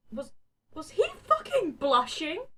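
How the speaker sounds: high, loud disbelief; shocked. A narrator's reading. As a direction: panic at the realisation. Inner panic at blushing.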